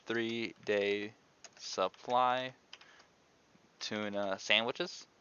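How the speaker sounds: slowly, writing while speaking; slow dictation pace. Real, not acted. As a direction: drag the words out as you write. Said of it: "I was writing it down".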